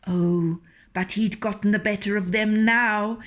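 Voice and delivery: usual speaking voice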